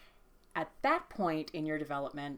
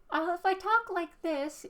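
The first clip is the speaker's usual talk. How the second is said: very high, loud squeaky cartoon mouse voice; high-pitched cartoon falsetto; Mickey Mouse; 6 dB louder than usual. Shown as a demonstration. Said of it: "Mickey Mouse or Minnie Mouse sort of voice".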